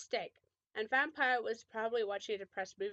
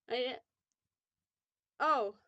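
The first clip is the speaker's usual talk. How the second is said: ordinary delivery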